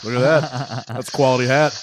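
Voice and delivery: a hint of a baseball announcer admiring the hat; lightly voiced TV announcer, mock praise. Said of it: "the announcer's going".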